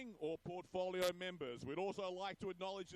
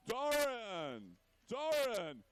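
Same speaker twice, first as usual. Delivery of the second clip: calling out; 5 dB louder, much slower than usual. Real, not acted. Calling birthday man over crowd.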